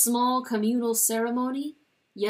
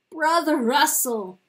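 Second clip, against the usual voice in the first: loud high call to the dead; 6 semitones higher, 7 dB louder than usual. Acted. Chanticleer calling to his dead brother, loudly. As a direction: cry the name into the dark.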